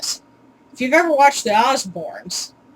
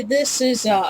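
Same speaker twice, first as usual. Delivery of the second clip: ordinary talk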